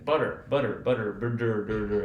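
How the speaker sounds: American "butter" with a clear D-like flap, repeated; "Butter, butter" the American way, tt as a tap; American flapped T. Retold or imitated. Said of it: "an American person is gonna say".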